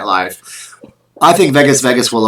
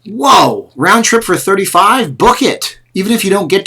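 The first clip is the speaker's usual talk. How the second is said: higher, louder, thrilled at a bargain; amazed excitement; 10 semitones higher, 3 dB louder than usual. Real, not acted. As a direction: react like you can't believe the price. Cheap flight deal in chat.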